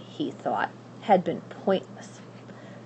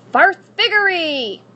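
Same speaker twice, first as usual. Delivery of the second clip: high, loud, slow incantation; casting a spell; 11 semitones higher, 9 dB louder than usual. Acted. Witch casting her spell, loud and high. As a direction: cast it with a flourish.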